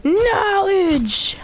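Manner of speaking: high, thrilled cry; excited shout. Real, not acted. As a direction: yell it with joy. Excited exclamation in game.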